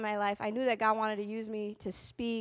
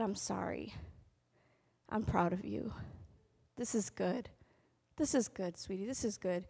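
tender apology; 4 semitones lower, 9 dB quieter than usual. Retold or imitated. Mom apologizing at ceremony.

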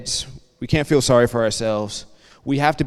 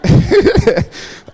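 high, loud burst of laughter; real laugh; 14 semitones higher, 7 dB louder than usual. Real, not acted. Telling students he quit with no plan, high laugh. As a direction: laugh out at your own story.

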